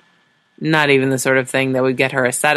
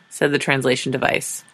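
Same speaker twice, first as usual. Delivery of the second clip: usual speaking voice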